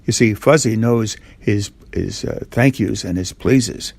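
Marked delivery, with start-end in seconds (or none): none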